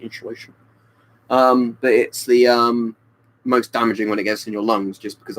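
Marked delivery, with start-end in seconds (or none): 0.0-0.5 quiet mock inshulation for insulation; hushed inshulation, a hint of Sean Connery. Shown as a demonstration. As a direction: softly echo insulation as inshulation. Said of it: "like Sean Connery saying that".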